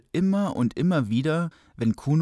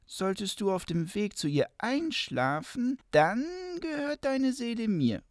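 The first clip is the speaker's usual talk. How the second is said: smooth polished devil; 10 semitones higher than usual. Acted. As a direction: sweet-talk him like a sly devil. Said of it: "sagte der Teufel".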